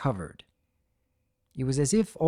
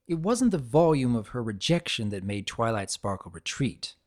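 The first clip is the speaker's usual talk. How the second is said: ordinary delivery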